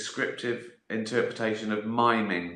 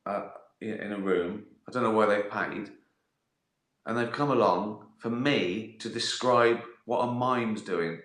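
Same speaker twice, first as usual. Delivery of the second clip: usual speaking voice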